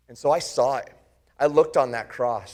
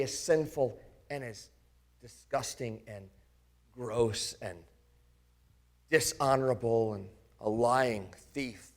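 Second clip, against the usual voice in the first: softer and lower with each word; fading shame; 5 semitones lower, 7 dB quieter than usual. Acted. Doubter listing his sins, fading into quiet shame. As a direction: let the shame drain the voice. Self-loathing questions.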